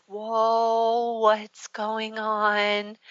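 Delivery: slow, drawn-out worried mom; a mother startled by her crying daughter; concerned mom. Retold or imitated. Mom sees her daughter bawling.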